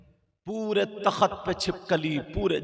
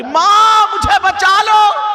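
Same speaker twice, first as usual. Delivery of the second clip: high, louder cry of माँ, मुझे बचा लो; mock scream for mother; 16 dB louder, much slower than usual. Girl screaming to her mother to save her, loud high cry. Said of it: "चिल्ला रही".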